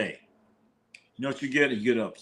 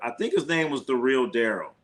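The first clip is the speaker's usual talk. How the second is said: ordinary delivery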